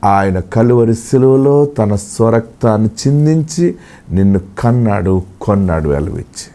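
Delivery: hushed prophetic consolation; prophetic word. Acted. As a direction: speak for God, low and close. God speaking to the listener.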